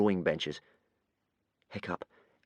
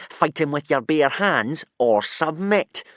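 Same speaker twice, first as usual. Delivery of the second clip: higher, slow mocking taunt; sneering; 6 semitones higher, 5 dB louder, slower than usual. A narrator's reading. Bully mocking Hiccup's choices, slow sneer. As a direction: drag out the taunt. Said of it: "sneered Snotlout".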